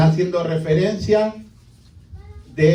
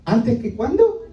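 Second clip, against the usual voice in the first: incredulous question; 5 semitones higher than usual. Real, not acted. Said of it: "rhetorical mocking question".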